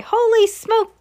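very high, loud exclamation; panicked yell. A narrator's reading. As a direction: yelp it in shock, high and loud. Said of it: "the man yelled".

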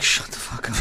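soft, toneless hiss of annoyance; mock hushed snap. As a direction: friends telling him to stop, hushed. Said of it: "everybody else is just like".

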